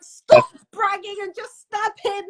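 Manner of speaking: high, drawn-out urging at the screen; acted exasperation. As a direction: plead with the fighter, slow and high-pitched. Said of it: "you're like".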